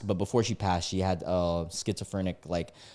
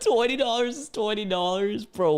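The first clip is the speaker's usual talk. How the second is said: very high, laughing disbelief; incredulous laughing repeat; 11 semitones higher than usual. Retold or imitated. Reader mocking the driver's reasoning, high and incredulous through laughter. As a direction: echo it mockingly, high-pitched and cracking up.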